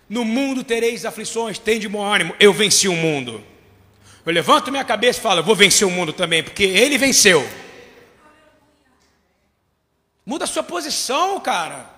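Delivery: loud, rousing preacher's cry; full-force preaching. Acted. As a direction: thunder it out to the congregation.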